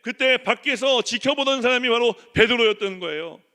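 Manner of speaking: loud, high, driving sermon delivery; preaching at full force. Acted. Pastor preaching hard to the church, loud and urgent.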